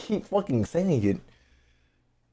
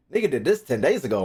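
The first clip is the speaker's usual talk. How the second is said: ordinary talk, nothing marked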